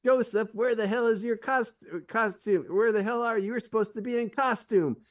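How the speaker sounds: a director yelling for a missing cast member; mock angry director. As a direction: play the fed-up director chewing out an actor. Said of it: "you hear the director go".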